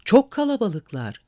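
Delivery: breathless at the window, pointing out the crowd; excited. A narrator's reading. Edith excitedly telling the men about the crowd. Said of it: "Heyecanlanmış bir şekilde".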